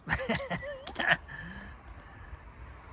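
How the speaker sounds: very high, soft giggling laugh; real giggle. Real, not acted. Hunters picking up rats, real high giggle.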